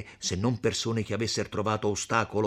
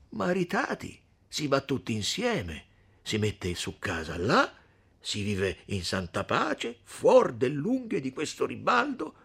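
lightly voiced young man, dreaming aloud; hopeful young Renzo; 7 semitones higher than usual. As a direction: paint a peaceful life together, then turn to Lucia. Renzo continues, dreamy then hopeful. (Renzo sognante e speranzoso, appena accennato)

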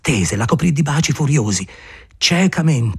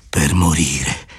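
slow low; 10 semitones lower, much slower than usual. Acted.

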